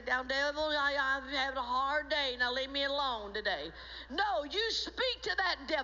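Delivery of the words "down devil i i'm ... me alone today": low, tired, feeble rebuke; mock a worn-out believer with no authority; weak pleading. Believer begging the devil after a hard day, weak and low. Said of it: "you don't say".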